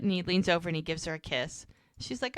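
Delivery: normal talking voice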